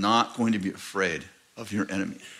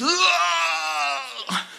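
high-pitched mock scream; imitated attacker's yell. Possessed man swings at the pastor, high mock scream. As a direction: mimic a wild demonic cry.

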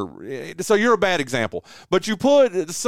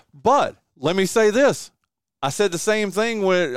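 ordinary talk

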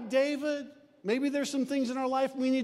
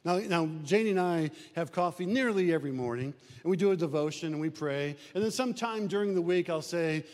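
ordinary talk

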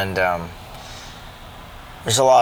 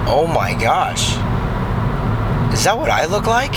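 shocked realization; 6 semitones higher than usual. Retold or imitated. Sudden self-realization in retold thought.

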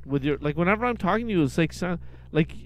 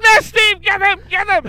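high, loud, frantic orders; frantic shouting; 12 semitones higher, 8 dB louder than usual. Retold or imitated. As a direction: shout in comic panic. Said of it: "They're like".

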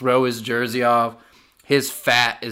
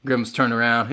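ordinary talk

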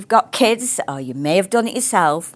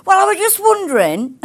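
sheepish son; 11 semitones higher than usual. Retold or imitated.

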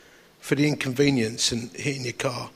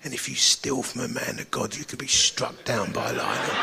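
mock warning; 4 semitones lower, 7 dB quieter than usual. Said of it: "what I wanted to say to her".

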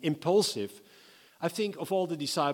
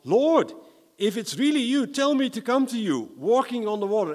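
calling out high and loud, full of hope; eager call; 8 semitones higher, 7 dB louder than usual. Acted. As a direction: shout it across the water, eager and bold. Peter calling out to Jesus.

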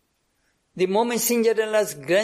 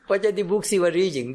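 laughing. Real, not acted. Laughing while describing checking homes.

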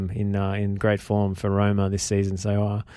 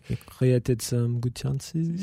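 put-on slow, careful French manager accent; a hammed-up French accent, drawn out; attempted French accent; 4 dB quieter, much slower than usual. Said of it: "Is that better?".

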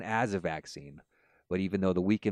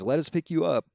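higher, louder call from above; lightly voiced rescuer calling out; helicopter rescuer; 9 semitones higher, 6 dB louder than usual. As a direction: call down to the man. Helicopter crew voiced in joke.

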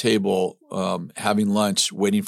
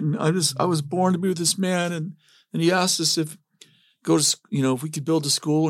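high, rambling retold confession; mock tearful; 7 semitones higher than usual. Husband telling his wife the whole story, mock tearful. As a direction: imitate yourself tearfully pouring it out, lightly overdone. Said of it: "I'm in tears".

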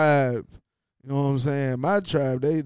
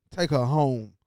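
ordinary delivery

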